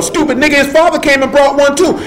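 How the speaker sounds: high-pitched, incredulous scorn; exasperated rebuttal. Real, not acted. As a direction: fire back at a foolish argument.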